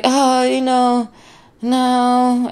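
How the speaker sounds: high, slow, dodgy mock voice; evasive boyfriend. Boyfriend dodging her question, slow and high in mock voice. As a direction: stall like you've been caught. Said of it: "he was just like".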